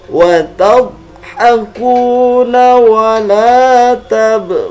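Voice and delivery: slow, careful Quran recitation; over enunciating. Shown as a demonstration. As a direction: chant each syllable deliberately. Recites verses, each twice.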